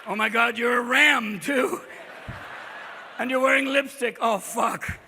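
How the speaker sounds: loud, hammed-up appalled wail; morning-after horror. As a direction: cry out in dawning horror. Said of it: "the morning after".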